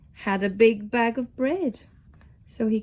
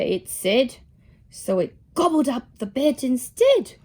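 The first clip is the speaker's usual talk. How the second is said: plain reading voice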